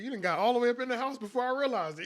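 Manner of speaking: high-pitched mock indignation of a dog; startled dog's voice. Owner speaking for his startled old dog, mock indignant. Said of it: "He was like".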